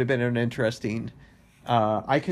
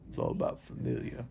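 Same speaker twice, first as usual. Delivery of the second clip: deep gravelly whisper; 9 dB quieter than usual. Retold or imitated. Mock Vin Diesel line.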